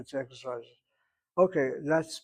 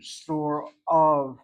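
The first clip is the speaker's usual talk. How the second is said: "store of" with "of" hit much louder; stress on "of"; 7 dB louder than usual. Shown as a demonstration. Teacher showing students construct emphasis, "of" stressed loudly. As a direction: lean hard on "of", louder, letting it fall. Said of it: "the emphasis is on the next word".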